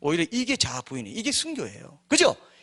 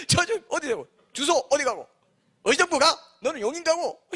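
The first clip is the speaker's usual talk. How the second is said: high, comic devil dispatcher with laughter; devil giving orders; 10 semitones higher, 3 dB louder than usual. Acted. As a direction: send minions off in a raised, playful voice. Devil dispatching his minions.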